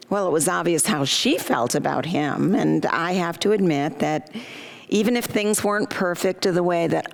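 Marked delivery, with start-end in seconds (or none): none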